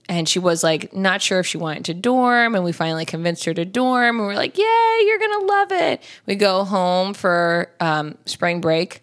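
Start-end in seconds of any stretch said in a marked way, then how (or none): 4.6-6.0 high, drawn-out mock cheer; retold cheering. Retelling how they convinced her to dorm, high cheery squeal. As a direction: imitate a very high, sing-out encouragement.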